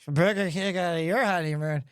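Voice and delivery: put-on low, slurred drunk echo; mushy words, no question in it; wasted. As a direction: repeat it back like a drunk. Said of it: "I am completely wasted".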